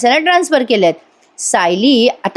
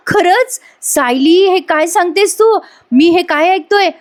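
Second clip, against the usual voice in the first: mock horror; 8 semitones higher, slower than usual. Husband to wife on hearing Priya cooked lunch, mock horror. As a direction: play the shock big, as if hit by bad news.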